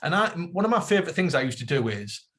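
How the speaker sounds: ordinary talk, nothing marked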